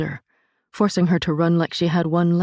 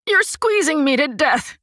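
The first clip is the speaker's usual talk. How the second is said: high, sharp annoyed voice; snapped complaint; 12 semitones higher, 3 dB louder than usual. A narrator's reading. Crushed passenger snapping at the pushing mother. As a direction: snap it at her. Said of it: "someone snapped".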